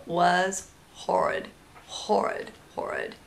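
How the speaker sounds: slow, fading to a breathless whisper; disgusted fade. Real, not acted. Mom recalling the horrid smell, fading disgust. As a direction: let it die away in disgust. Describing awful diaper smell.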